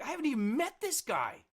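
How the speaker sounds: pitched far up, mock frantic disbelief; retold exasperation. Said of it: "And I'm like".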